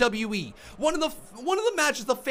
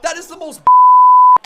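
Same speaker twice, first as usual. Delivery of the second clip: a far higher, much louder, slow scream that breaks off; raging scream; 13 dB louder than usual. Real, not acted. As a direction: scream it in real fury. Furious rant about the ending.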